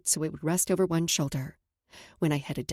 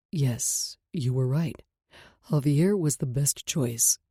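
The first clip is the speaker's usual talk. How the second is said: lower, tender male voice; man's voice; 5 semitones lower, slower than usual. Acted. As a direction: speak as a calm man.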